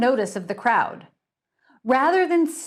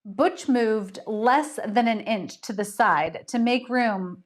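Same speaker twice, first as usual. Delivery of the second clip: usual speaking voice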